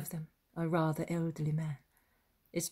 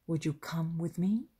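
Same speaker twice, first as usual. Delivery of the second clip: usual speaking voice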